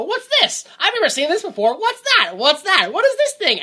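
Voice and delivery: high, loud, baffled pilgrim voice; confused pilgrim. Pretend. Pranksters posing as time-travelling pilgrims, high baffled voice. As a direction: squeal it out like a lost time traveller. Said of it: "pretend to be pilgrims coming back".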